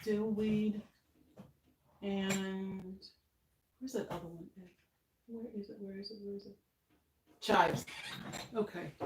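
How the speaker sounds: quiet mumble while searching; half under the breath, to herself; muttering to herself. Real, not acted. Searching the spice rack, to herself.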